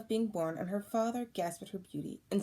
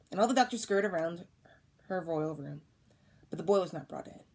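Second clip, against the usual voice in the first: ordinary delivery, nothing marked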